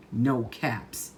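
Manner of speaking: plain reading voice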